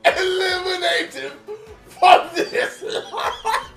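high, slow, tearful dramatic line; anime character voice. Retold or imitated. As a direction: ham it up like an anime dad. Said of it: "Why are you speaking like this".

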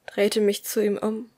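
plain reading voice

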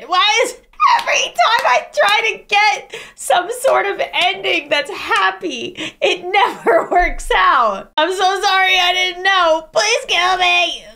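loud, laughing through the despair; real distressed wail. Real, not acted. Player wails at another sad ending, laughing in despair.

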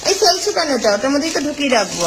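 high, quick reproach to the helper; sharp scolding. Real, not acted. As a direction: scold him for dropping it. Scolding Saikat over the cake. (হালকা ধমক দিয়ে, উঁচু গলায়)

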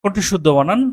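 ordinary talk, nothing marked

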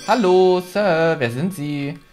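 ordinary delivery

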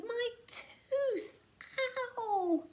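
high drawn-out wail; pained, high-pitched cry; screamed in pain. A narrator's reading. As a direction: wail with a sore tooth. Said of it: "Tiny screamed".

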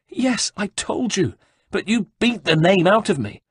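high, pleading monk voice; desperate pleading. Acted. Beaten monk pleads with the guards, high and desperate. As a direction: beg for mercy through the pain.

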